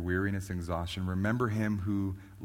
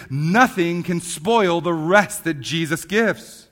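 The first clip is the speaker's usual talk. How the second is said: raised, ringing sermon voice, building louder and higher; impassioned preaching; 8 semitones higher, 11 dB louder than usual. Real, not acted. Preacher assuring the congregation of the rest Jesus gives, impassioned preaching. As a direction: preach it with mounting force and conviction.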